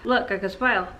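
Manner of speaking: normal talking voice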